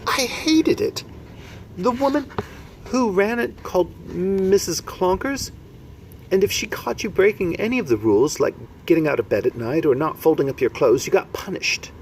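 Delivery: put-on a small orphan girl remembering; little girl voice. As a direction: Sophie telling of Missus Clonkers. Sophie's voice, much higher.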